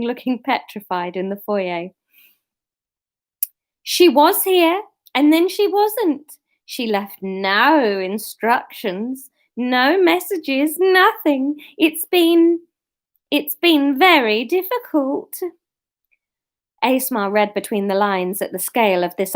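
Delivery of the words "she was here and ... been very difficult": high, loud camp drawl; Kenneth Williams voice. Acted. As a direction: whine it in a camp comic voice. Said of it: "Kenneth Williams".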